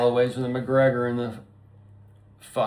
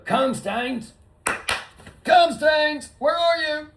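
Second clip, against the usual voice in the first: high, louder calling yell; calling the dog; 5 dB louder, slower than usual. Pretend. At the dog park, owner calling his dog, high loud mock yell. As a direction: call out for your dog at the park. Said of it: "you go".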